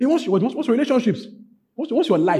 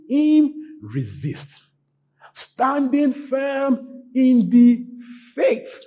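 slow, spaced-out words, rising high; emphatic verse reading; 9 semitones higher, 4 dB louder, much slower than usual. Real, not acted. Preacher quoting scripture to congregation, slow emphatic proclamation. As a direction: ring out each word of the verse slowly.

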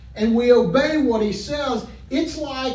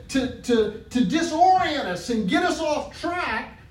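loud, higher fervent preaching; preaching at full force; 4 semitones higher, 3 dB louder than usual. Acted. Preacher urging the church to trust God, fervent. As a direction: lift the congregation with fire.

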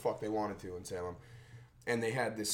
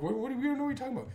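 high, rushed fake innocence; fast innocent denial; 13 semitones higher, much faster than usual. Kids denying to the angry driver, fast fake innocence. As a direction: rattle off the lie like scared kids.

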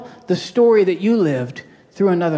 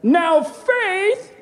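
much higher, drawn-out mock preacher voice; Kenneth Copeland impression; 11 semitones higher than usual. Pastor parodies a televangelist's slow build, high drawn-out preacher voice. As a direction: stretch it out like a showman preacher. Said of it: "You don't have to sound like Kenneth Copeland".

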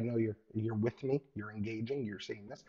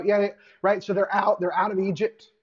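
usual speaking voice

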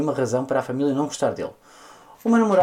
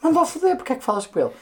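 resposta indignada; 8 semitones higher, faster than usual. Retold or imitated.

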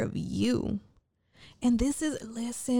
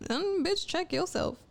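much higher, sassy mock scold; sassy callout; 9 semitones higher than usual. A friend telling her off, a high sassy scold. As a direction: snap back like a sassy friend.

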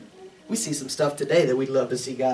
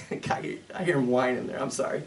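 usual speaking voice

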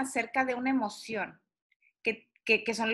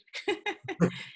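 short nervous giggle; nervous laugh; 7 semitones higher, 3 dB quieter than usual. Real, not acted. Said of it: "Me hace reír como de nervios".

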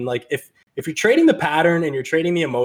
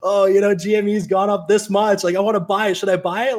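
eager newbie; 7 semitones higher than usual. Retold or imitated. Eager people asking about stock.

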